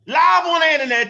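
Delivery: high, loud disbelief; outraged exclamation. Real, not acted. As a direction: shout it out in amazement. Outraged at public slapping threat.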